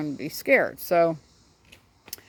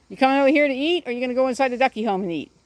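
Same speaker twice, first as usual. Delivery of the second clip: pet talk; 5 semitones higher, 4 dB louder, faster than usual. Real, not acted. Talking to the duck.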